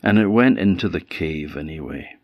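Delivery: usual speaking voice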